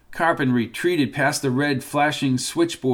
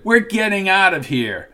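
defiant shout; 5 semitones higher, 4 dB louder than usual. Acted.

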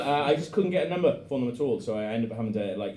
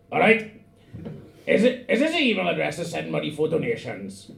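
put-on cheeky, loud Welsh accent; hammy Welsh caller voice; 4 semitones higher, 5 dB louder than usual. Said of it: "I'm gonna do it in that fucking hot shit Welsh voice".

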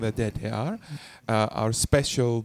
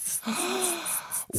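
dramatic gasp; 8 dB quieter than usual. Acted. Mock shock at the guest.

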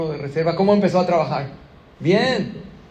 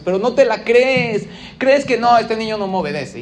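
much higher and louder, driving it home; emphatic preaching; 6 semitones higher, 7 dB louder than usual. Real, not acted. Preacher challenges the listeners' doubt, loud and emphatic. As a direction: call the congregation out, full force.

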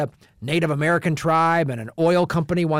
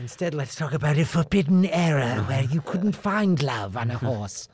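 put-on spoof a stuffy British costume-drama accent; mock period-drama accent. Host mocks period romance films in a lightly put-on British accent. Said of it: "That was a great accent work".

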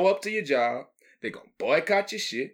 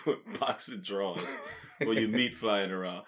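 laughing; 6 semitones lower, 8 dB quieter than usual. Real, not acted. Repeating the joke while cracking up.

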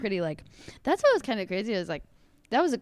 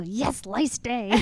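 slow, drawn-out glee; excited kid quote; 3 semitones higher, much slower than usual. Retold or imitated. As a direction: savor it like a treat. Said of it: "I'd go".

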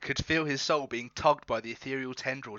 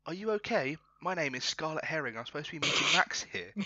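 higher-pitched, gently voiced young woman; young lady; 3 semitones higher than usual. Scarlet introducing herself to Marmalade, young lady voice. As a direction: lift the voice high for a young lady. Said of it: "reveals herself as a young lady".

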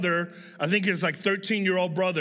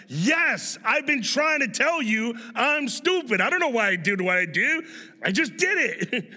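exasperated confession; 4 semitones higher than usual. Retold or imitated. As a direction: blurt it out in comic exasperation. Replaying outburst to mentor who scolded him.

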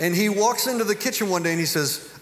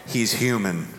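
son's dawning thought; 6 semitones lower than usual. Retold or imitated. Son realises he can win.

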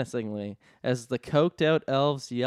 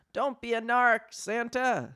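elf taunt; 9 semitones higher than usual. Acted. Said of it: "said someone else".

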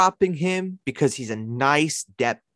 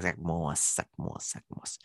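put-on Long Island accent fading to near whisper; 6 semitones lower, 13 dB quieter than usual. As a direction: savour it softly, Long Island style. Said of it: "say Zach Moss in your Long Island accent".

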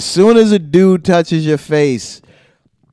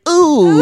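high-pitched mock "ooh"; mimicked pained ooh; 10 semitones higher than usual. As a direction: teasingly copy someone's yelp at being hit. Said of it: "that's the sound you made".

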